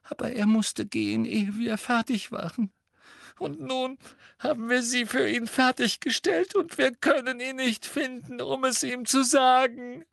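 put-on very high, tearful girl's voice; gasping distress. A narrator's reading. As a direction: wail the explanation in gasps. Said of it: "keuchte Hermine".

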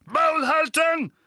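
very high, loud, slow roar; thundering. A narrator's reading. Vernon and Petunia silence Harry, thundering. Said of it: "donnerten Onkel Vernon und Tante Petunia".

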